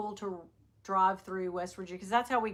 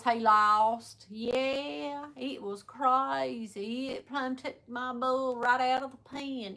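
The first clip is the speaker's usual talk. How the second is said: country drawl; 2 semitones higher, slower than usual. Retold or imitated. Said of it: "most country person around".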